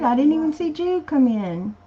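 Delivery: ordinary talk, nothing marked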